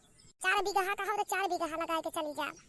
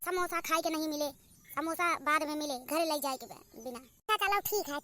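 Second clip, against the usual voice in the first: ordinary talk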